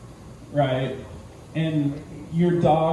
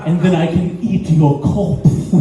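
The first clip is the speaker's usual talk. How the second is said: louder creepy feline voice; creepy cat voice; 5 dB louder than usual. Acted. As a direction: purr it like a predator sizing up prey. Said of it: "It's like".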